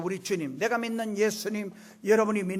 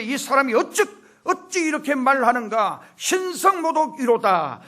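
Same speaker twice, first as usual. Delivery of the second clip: higher, louder voice of outraged objectors; indignant scribes; 7 semitones higher, 8 dB louder than usual. Acted. Scribes objecting to Jesus's claim, indignant. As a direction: protest the blasphemy, drawing out the last word.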